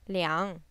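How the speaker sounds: low dip on liang, then up; falling-rising tone on liang. Shown as a demonstration. Teacher has learners say it again, falling-rising liang. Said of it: "This is a falling rising tone".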